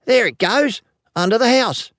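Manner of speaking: put-on high, excited boy's cry; high-pitched boyish shout of discovery; triumphant yell. A narrator's reading. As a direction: yell it out in triumph like a young lad. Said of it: "Then he yells triumphantly".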